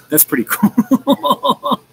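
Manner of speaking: pleased words dissolving into drawn-out laughter; laughing. Real, not acted. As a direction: break into a high laugh mid-phrase. Laughs at own good reviews.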